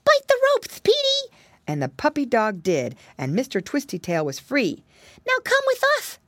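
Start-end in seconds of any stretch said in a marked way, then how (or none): 0.0-1.3 very high, loud order; urgent cry. Acted. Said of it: "cried Flop". 5.3-6.2 very high urgent call; eager cry. Acted. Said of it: "cried Flop".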